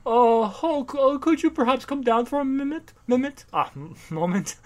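mock posh caller; fawning rich man. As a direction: coax the monster politely, hammed up. Rich folk calling up to Hulk.

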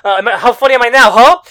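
loud, high, scornful tirade; angry taunting. Retold or imitated. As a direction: hurl insults at a despised rival. Acting out taunting rival hero.

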